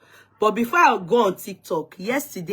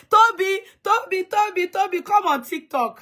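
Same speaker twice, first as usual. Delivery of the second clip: calling out; 11 semitones higher than usual. Real, not acted. Calling Toby to join.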